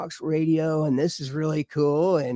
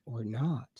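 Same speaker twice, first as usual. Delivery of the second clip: deadpan echo; 3 semitones lower, 10 dB quieter than usual. Retold or imitated. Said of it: "I'm like".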